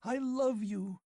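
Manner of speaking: put-on girl clinging to him in wild passion; fierce declaration. A narrator's reading. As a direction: cry it out fiercely. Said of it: "she cried fiercely".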